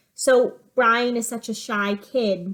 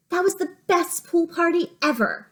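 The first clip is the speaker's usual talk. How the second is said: put-on higher, a touch of a thrilled child; lightly voiced excited kid; 7 semitones higher than usual. Teacher voicing a classmate for her pupils, lightly voiced and excited. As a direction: voice a happy kid bragging about a party. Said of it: "the author may add who is speaking".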